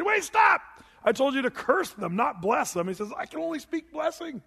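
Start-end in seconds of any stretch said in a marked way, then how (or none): 0.0-2.9 high shouted interruption, easing off; alarmed protest. Acted. Balak interrupts Balaam in protest. 3.1-4.4 high, small put-on voice; helpless Balaam. Balaam's helpless reply to Balak.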